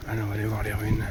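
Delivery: loud over the wind; shouting over wind. Real, not acted. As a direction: raise the voice above gusts. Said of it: "Ah, le vent se lève, désolé".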